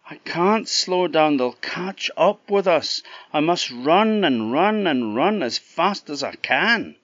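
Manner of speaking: breathless, determined to save him; girl in mule form. Acted. The girl telling Jack she's rescuing him, urgent.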